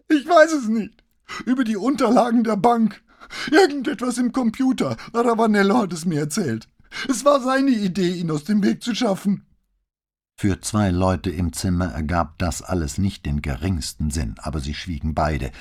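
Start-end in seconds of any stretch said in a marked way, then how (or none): 0.1-9.4 hoch gepresst, den Tränen nah; hohe, gepresste Stimme. A narrator's reading. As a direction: mit enger Kehle herauspressen. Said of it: "mit hoher, gepresster Stimme, offenbar den Tränen nah". (gepresst und weinerlich)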